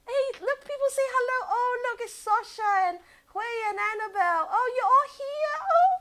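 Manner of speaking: high gushing voice at familiar names; light up as friends say hello; real emotional delight; excited, touched. Real, not acted. Sees friends' names in comments.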